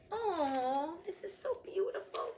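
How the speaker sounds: high, tearful squeak; real welling-up, voice climbing high; tearfully moved. Real, not acted. Reader moved by the story's ending, about to cry. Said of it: "You know I'm gonna cry".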